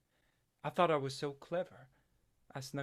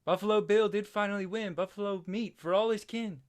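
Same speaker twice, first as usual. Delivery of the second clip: loud, higher triumphant chant; calling up; 8 semitones higher, 10 dB louder than usual. Retold or imitated. Boy calling up to his brother in the tree, loud triumphant chant. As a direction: crow the victory up into the tree. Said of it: "I called up there".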